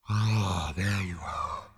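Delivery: drawn-out, airy greeting; ethereal voice. A narrator's reading. Voice reappearing in Zaphod's ear, ethereal. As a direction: sigh it out like a spirit arriving. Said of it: "said the voice suddenly in Zaphod's ear".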